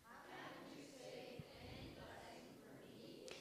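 distant group echo. Real, not acted. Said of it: "Can you say that?".

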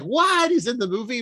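very high, loud shriek of surprise; mock astonishment. Podcaster imitating companion spotting actor, exaggerated astonishment. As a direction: imitate a stunned viewer, pitch shooting up.

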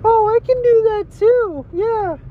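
put-on squeaky, very high falsetto girlfriend voice; high-pitched comic girlfriend; Aaron Rodgers' girlfriend. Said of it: "Aaron Rodgers' girlfriend".

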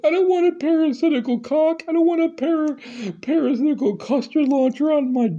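shrieking falsetto panic, half crying; high panicked shriek. Retold or imitated. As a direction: squeal in terror at a dick on the head. Panicked reply to the doctor.

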